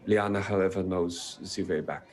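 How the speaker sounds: a light German Matron Mother; German accent. Acted. Matron Mother warns the party about the worms, in a German accent. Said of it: "butchering the accent".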